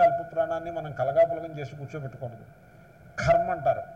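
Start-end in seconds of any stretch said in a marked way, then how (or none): 3.2-3.5 "కర్మ" with a heavily aspirated first sound, like kharma; wrongly aspirated K. Shown as a demonstration. Teacher mimicking a common mistake, "కర్మ" with its first consonant wrongly aspirated. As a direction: open "కర్మ" on a breath-heavy kh instead of k. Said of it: "కకారాన్ని మహాప్రాణం చేసి కూర్చోబెట్టారు".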